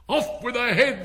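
high, loud royal shout; full-voice shout. A narrator's reading. Queen ordering Alice's execution, shouting at full voice. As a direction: shout the order at the top of your voice. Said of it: "shouted at the top of her voice".

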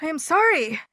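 high, gasped apology; lightly acted out-of-breath voice; acted gasping. A narrator's reading. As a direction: apologise as if winded from running. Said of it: "gasped Frog".

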